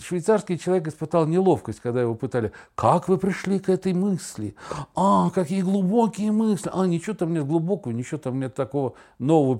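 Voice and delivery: usual speaking voice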